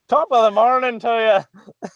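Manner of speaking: high-pitched mock Irish accent. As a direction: put on a playful Irish lilt, pitched high. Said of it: "That's the best Irish accent".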